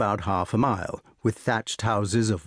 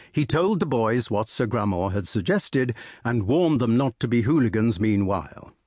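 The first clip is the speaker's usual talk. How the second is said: ordinary delivery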